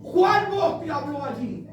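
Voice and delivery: forceful sermon delivery, building to a shout; preaching. Acted. Preacher warns congregation about the voice of man, forceful preaching. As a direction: preach it out, stretching the key phrases.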